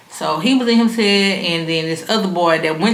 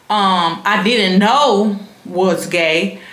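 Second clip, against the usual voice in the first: ordinary delivery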